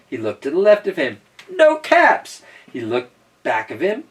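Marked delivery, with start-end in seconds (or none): 1.5-2.4 high, loud exclamation; exclaimed discovery. Acted. Storyteller acts out the peddler's search, exclaiming high. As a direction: pitch it way up and punch it.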